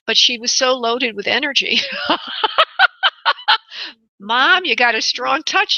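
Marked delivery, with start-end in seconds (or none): 1.8-3.9 a bright, high burst of laughter; real laugh. Real, not acted. Medium laughing about the spirited mother.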